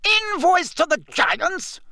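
very high incredulous question; scandalised shriek, rising at the end. A narrator's reading. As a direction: shriek it back in disbelief, drawn out. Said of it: "Fudge shrieked".

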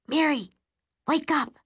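plain reading voice

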